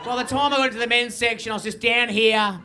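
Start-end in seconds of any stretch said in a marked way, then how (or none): none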